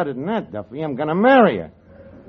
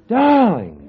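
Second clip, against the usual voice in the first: a big, loud, mock-passionate "Darling!"; a loud, hammy swoon of a lover rehearsing on a moose head; hammed-up loving cry; 6 semitones higher, 9 dB louder than usual. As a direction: overplay the lover, crying it out. Practising romance on the moose head.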